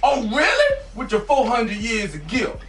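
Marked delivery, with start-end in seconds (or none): 0.0-0.8 drawn-out, high mock shock; mock disbelief. Teasing the boss who never heard of her, mock disbelief. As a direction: act scandalized that he doesn't know.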